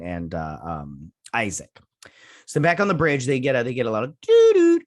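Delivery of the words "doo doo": chime noise. Retold or imitated. Imitating the ship's intercom chime.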